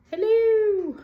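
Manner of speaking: high, loud sudden greeting; excited hello. Real, not acted. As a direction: call out a bright hello.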